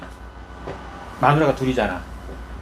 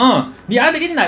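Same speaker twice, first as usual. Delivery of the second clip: higher, louder sneering jab; mocking taunt; 9 semitones higher, 5 dB louder than usual. Rival wife taunting childless Hannah, sneering and higher. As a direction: needle her about having no sons. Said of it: "이러면 막 맨날 막 사람을 막 달달달달 막 해".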